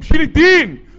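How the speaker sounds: high, loud قوم; rally the crowd to rise; crowd-rousing cry; shouted refrain. Acted. Refrain build.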